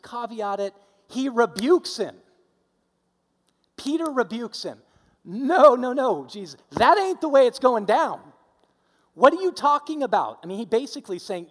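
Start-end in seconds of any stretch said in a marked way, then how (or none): 5.2-10.4 louder, higher, exasperated protest; angry rebuke. Retold or imitated. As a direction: scold him like he's lost it. Peter rebuking Jesus.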